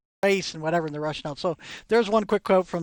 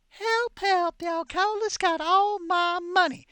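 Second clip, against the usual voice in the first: high, slow mock wail of a dandy; pitched-up prissy plea; foppish cry for help; 10 semitones higher, slower than usual. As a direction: squeal for help like a helpless fop. Powdered-wig face cries out.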